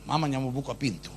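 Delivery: ordinary talk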